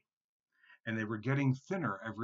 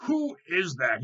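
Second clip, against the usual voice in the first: loud, high challenge; troll's roar; 7 semitones higher, 9 dB louder than usual. A narrator's reading. Troll challenges middle goat on the bridge, loud roar. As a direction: roar out the question. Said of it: "he roared".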